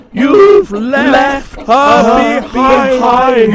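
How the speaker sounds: high, loud, slow wail; wailing coaches. A narrator's reading. Coaches wailing after the left-behind lady. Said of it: "Wailed the coaches".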